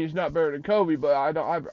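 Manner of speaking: ordinary delivery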